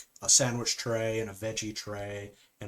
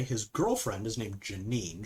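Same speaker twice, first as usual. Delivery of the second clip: ordinary delivery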